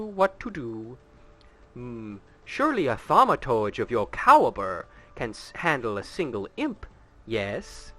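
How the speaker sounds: theatrical, sly noble voice with high flourishes; scheming courtly villain. Acted. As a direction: play a smooth, scheming aristocrat toying with the hero. Reads game dialogue in character.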